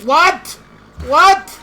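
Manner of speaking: indignant disbelief at the intruder; outraged double take; shocked what. Real, not acted. Intruders enter player's territory.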